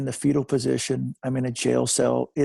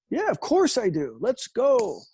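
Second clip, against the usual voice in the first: higher-pitched, over-eager agreement; eager people pleaser; 7 semitones higher, slower than usual. Retold or imitated. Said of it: "you're a people pleaser. I'm a people pleaser".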